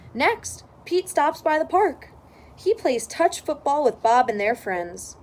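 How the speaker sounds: usual speaking voice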